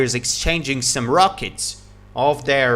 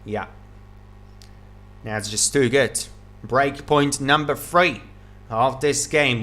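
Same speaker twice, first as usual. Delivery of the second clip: usual speaking voice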